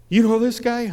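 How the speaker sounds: high, loud tough-guy demand; menacing challenge. Retold or imitated. Gang member confronting him.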